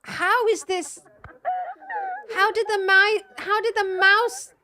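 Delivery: high, loud, stunned disbelief; loud amazement. Real, not acted. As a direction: cry out in astonishment at what you see. Amazed by a mouse video.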